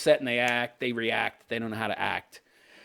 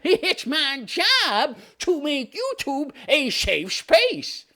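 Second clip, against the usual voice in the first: mocking high, slow prissy CEO voice; Susan Wojcicki; 10 semitones higher, much slower than usual. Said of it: "That and Susan Wojcicki".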